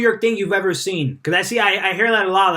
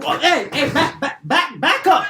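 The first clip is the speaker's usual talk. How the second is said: higher and laughing, shooing someone off; mock back-off warning; 7 semitones higher than usual. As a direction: push a stranger back with half-joking alarm. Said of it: "I'm like".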